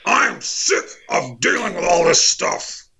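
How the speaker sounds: Alex Jones rant. Pretend. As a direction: rant like a wronged talk-radio host.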